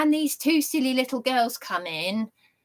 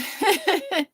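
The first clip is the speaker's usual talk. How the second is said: mocking laugh; 9 semitones higher than usual. Mocking the officer.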